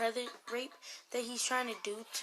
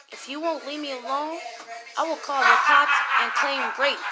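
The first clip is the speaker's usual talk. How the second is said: higher, much louder threat breaking into a laugh; 5 semitones higher, 9 dB louder than usual. Retold or imitated. Girl threatens the stalker with the cops, loud and laughing. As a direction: blurt the threat half-laughing.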